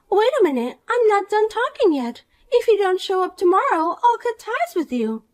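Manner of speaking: put-on snide rich girl. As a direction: talk down to her with sweet contempt. Poppy threatens.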